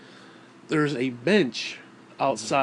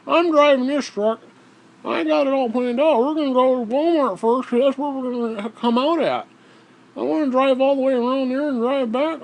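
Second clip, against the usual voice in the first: high, louder bossy mock voice; mock Dale T; 9 semitones higher, 4 dB louder than usual. Dale T insisting on his route, high pompous mocking voice. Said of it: "He's like".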